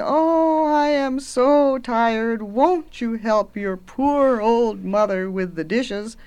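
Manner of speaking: slow, drawn-out weary mother; hammed-up exhausted complaining, starting high; tired mother. A narrator's reading. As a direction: plead for help with long, dragging words. Weary mother begs for help.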